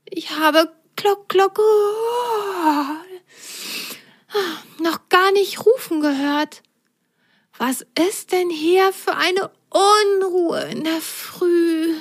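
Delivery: a just-woken snake wondering at the fuss; little snake. Acted. Snake asks about the early commotion, high and puzzled.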